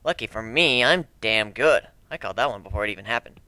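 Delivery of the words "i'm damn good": ordinary delivery, nothing marked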